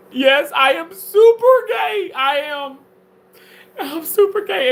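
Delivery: high, loud hammed-up declaration; mock shout. Mock outburst on stream.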